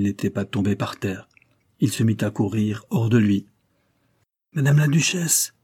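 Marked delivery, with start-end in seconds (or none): 4.5-5.5 shouted announcement. Acted. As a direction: call out the news across the yard. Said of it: "cria-t-il".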